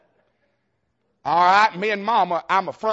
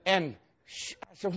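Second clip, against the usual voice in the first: ordinary talk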